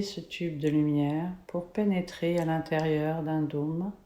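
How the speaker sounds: slow, soft meditation voice; guided meditation. Acted. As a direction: guide them into calm, slowly. Leads a guided color meditation.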